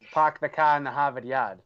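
classic movie-style Boston accent; Boston accent, dropping every R. Shown as a demonstration. Asked for an example of a Boston accent, thick Boston accent. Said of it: "the classic, you know, T V show movie line".

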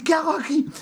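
high, startled sleepwalker cry; panic, half-asleep and lost; confused panic. Retold or imitated. Waking on the sofa, crying out confused to himself. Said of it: "Pero así".

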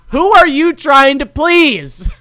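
high, slow disbelieving question; incredulous. Real, not acted. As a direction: ask it in amused disbelief. Incredulous ranting, laughing.

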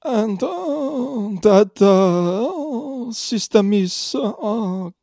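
high, slow hesitant; halting Luigi voice. Acted. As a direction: stumble through the memorized line. Luigi fumbles the line.

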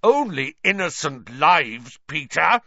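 loud, high, forceful rebuke; outraged retort; terrible fury. A narrator's reading. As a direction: drive it home in anger. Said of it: "with a terrible fury".

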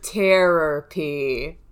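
"therapy" drawn out as "terror-py", hard T; terror pun. Shown as a demonstration. Repeating a missed pun to co-hosts, "therapy" as slow terror-py. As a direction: slowly sound out "therapy" to start like terror. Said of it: "Like terror".

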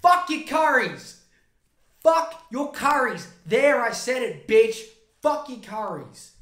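loud, slow, high ranting yell; mock shouting. A heckler yelling at the PM, loud and slow. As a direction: bellow it like a furious heckler. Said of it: "this weird mouth thing where he goes".

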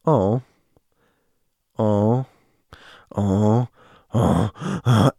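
heavy huffed breaths, slowly repeated; mimicking a friend's eerie panting; imitated monster breathing. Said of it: "he just starts breathing like some monster".

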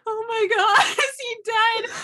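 high, loud, laughing panic; mock panic. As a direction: freak out half-joking. Retold panic over Comac.

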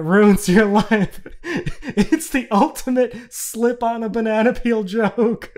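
cracking up mid-sentence; laughing. Real, not acted. Comic amused by his own dark joke, laughing.

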